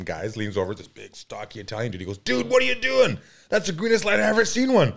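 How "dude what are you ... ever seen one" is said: loud, high mock exasperation; incredulous. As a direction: imitate a buddy who can't believe it. Acts out friend's incredulous scolding.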